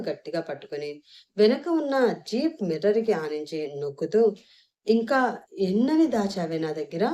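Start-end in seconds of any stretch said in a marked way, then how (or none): none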